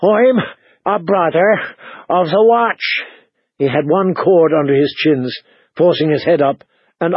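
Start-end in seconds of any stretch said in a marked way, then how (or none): 0.0-3.0 high, halting, breath-short voice; anxious, high-voiced captive; frightened fat man. A narrator's reading. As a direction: puff it out in short bursts. Still breathing like a bellows.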